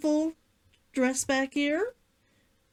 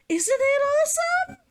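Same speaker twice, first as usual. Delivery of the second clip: giddy squeal of delight; high excited squeal; 11 semitones higher, 3 dB louder than usual. Real, not acted. Showing off her costume to viewers, giddy squeal.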